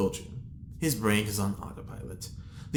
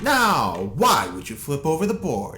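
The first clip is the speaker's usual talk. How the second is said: loud scolding; 3 semitones higher, 10 dB louder than usual. Acted. Hugo scolding over flipped board.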